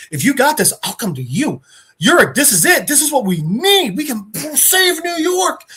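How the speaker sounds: high, swooping, overjoyed exclamation; imitated excitement. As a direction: act out a thrilled editor, stretching the last words high. Jameson thrilled at the scoop.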